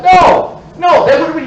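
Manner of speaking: a sudden shouted no; loud protest. Retold or imitated. To his mom about returning the spoons, a sudden loud no. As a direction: cut her off with a loud no.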